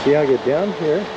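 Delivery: ordinary delivery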